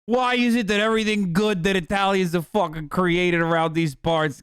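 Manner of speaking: aggrieved, hammed-up New Jersey Italian; New Jersey Italian accent. Assimilated immigrant griping about Columbus Day, New Jersey Italian accent. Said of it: "all of a sudden out of nowhere, I'm like".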